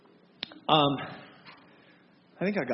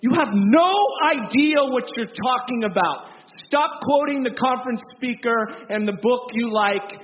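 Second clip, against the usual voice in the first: louder and higher, almost shouting; passionate rebuke; 3 semitones higher, 5 dB louder than usual. Retold or imitated. Preacher answering flippant believers, raised and indignant. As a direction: rebuke them hard, voice raised. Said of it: "I'm like".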